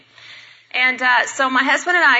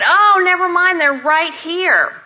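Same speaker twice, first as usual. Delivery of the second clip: high, loud cry of discovery; loud exclamation; 8 semitones higher, 6 dB louder, slower than usual. Retold or imitated. Speaker to cashier on spotting her flowers, loud exclamation. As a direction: exclaim it loudly, pitched way up. Spots flowers beside register, calls out.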